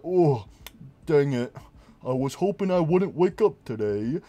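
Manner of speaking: lightly voiced gloomy robot; a hint of a sad robot; mopey Golden Freddy. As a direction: sigh it out like a depressed animatronic. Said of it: "he's like".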